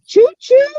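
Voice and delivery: high, loud playful train whistle; train choo choo. Pretend. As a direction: toot like a toy train. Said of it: "What's a choo choo sound".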